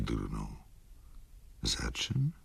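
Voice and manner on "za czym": breathy whispered question, rising at the end; whispered echo. A narrator's reading. Wokulski, startled, whispers the question back to Ignacy.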